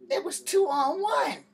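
high, loud and slow, stressing each word; exasperated outburst. Retold or imitated. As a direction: spell out the obvious in disbelief.

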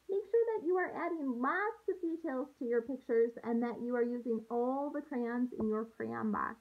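ordinary delivery

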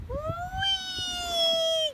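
long high sing-song whee; playful whee. Real, not acted. Cheering child down the slide.

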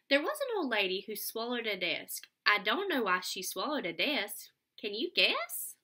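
lively read-aloud storytelling voice; sing-song picture book reading; reading to children. Acted. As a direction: read it out with bounce for little kids.

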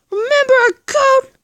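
high, loud demanding repeat; loud insisting. Acted. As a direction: shout it, demanding he remembers. Third repeat, loudest.

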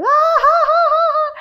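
high, loud imitated guffaw; mock laughter. Casting people laughing at her during the take, mock laughter. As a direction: imitate them cackling loudly and high. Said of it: "they would start laughing".

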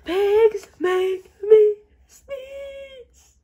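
slow, loud, crying wail; wailing lament. Acted. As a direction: wail the diagnosis. Gerald wails to Doctor Cat.

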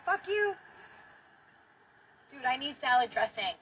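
normal talking voice

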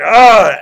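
a loud, high, sudden angry "Ugh"; angry grunt. Retold or imitated. Preacher acting out Jesus warning the healed leper, loud angry grunt. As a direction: grunt it out in sharp indignation. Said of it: "because you're angry".

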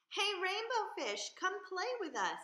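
high, bright call to play; high playful fish voices; calling fish friends. Acted. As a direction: call him over, high and eager.

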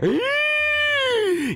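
long high squealing gasp; sharp, very high intake of alarm; shrill panicked gasp, drawn out. Real, not acted. Wave is coming for the player.

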